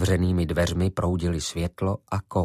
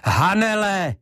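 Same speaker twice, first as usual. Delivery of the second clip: full-voiced shout; 11 semitones higher, 4 dB louder, slower than usual. Ivo getting no answer, shouting Hanele at full voice. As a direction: shout her name high and loud. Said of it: "křičel plným hlasem".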